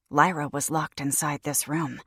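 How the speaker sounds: ordinary delivery, nothing marked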